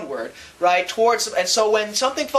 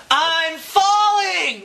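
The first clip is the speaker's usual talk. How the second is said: drawn-out, high, panicked cry; insides screaming; 8 semitones higher, much slower than usual. Pretend. Your insides yell at you in orbit, slow high alarmed cry. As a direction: stretch it out slowly like your gut is yelling in alarm. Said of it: "all your insides are screaming out to you".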